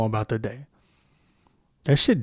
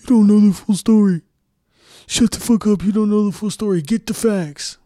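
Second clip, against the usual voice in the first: much higher and louder whiny mock voice; mocking online arguer; 9 semitones higher, 7 dB louder than usual. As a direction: sneer like a know-it-all commenter. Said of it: "This is what they".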